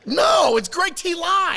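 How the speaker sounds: high, loud and slow, stressing "Greg T Live"; shouted insistence. Real, not acted. Host correcting the guest about GTL, shouting insistently. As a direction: shout "No, it's Greg T Live" to settle the argument. Insisting on his podcast name.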